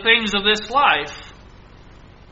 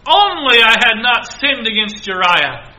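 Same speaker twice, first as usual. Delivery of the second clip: loud, high remorseful lament, fading at the end; David mourning Absalom, loud then sinking; grieving confession; 5 semitones higher, 7 dB louder than usual. Acted. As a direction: plead with guilt and grief. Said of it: "he is saying".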